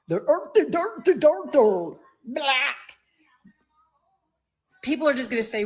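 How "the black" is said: gibberish imitation of a Dutch accent; mock Dutch accent. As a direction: garble it like a mocked Dutch accent. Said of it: "pop out with that Dutch accent".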